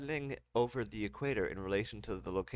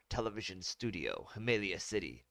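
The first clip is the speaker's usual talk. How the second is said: usual speaking voice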